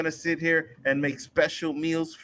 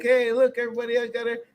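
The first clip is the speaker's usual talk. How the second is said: much higher voice, lightly showing off; mock proud gift-giver; 10 semitones higher, faster than usual. Parent presenting the Tesla on camera, mock proud and high. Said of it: "I'm like".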